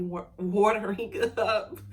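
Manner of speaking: laughing through tears; real teary giggle; light laugh while welling up. Real, not acted. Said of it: "my eyes are already watering".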